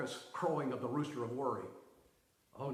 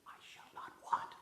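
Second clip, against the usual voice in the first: whisper; 6 dB quieter than usual. Shown as a demonstration. Repeating the verse, softly.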